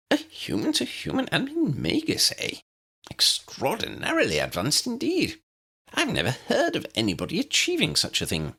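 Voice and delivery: put-on high, admiring old wizard voice; small wizard, impressed; eager little professor. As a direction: pitch it up and marvel. Narrator voices Flitwick.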